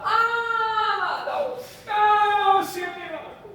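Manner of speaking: high, sung-out wail breaking into a shout; a desperate mother begging Jesus; crying plea. Acted. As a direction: cry out for mercy in anguish. Woman cries out to Jesus.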